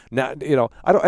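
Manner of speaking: usual speaking voice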